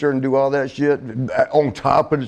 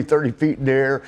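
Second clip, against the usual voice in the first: normal talking voice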